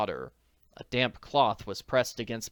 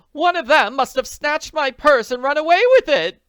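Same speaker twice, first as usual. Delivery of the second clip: loud, high frantic outcry; loud high narrated scream; 13 dB louder than usual. A narrator's reading. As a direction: shriek the accusation. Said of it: "she screamed".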